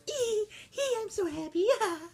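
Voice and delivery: high, giggly mock princess voice; giggly Aurora. Kid mocking Aurora's constant happy giggling, high voice. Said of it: "all she did was go".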